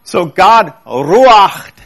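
ruached said slowly, with a breathy, forced ch; God ruached, with the breath pushed out. Shown as a demonstration. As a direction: push air out on ruached. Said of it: "force some air out of your lungs".

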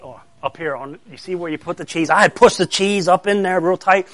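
ordinary delivery

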